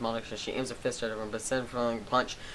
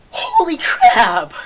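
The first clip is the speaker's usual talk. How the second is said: much higher, far louder, slow yell; shocked shout; 16 dB louder, much slower than usual. Real, not acted. Gamer yelling at the plot twist, drawn out.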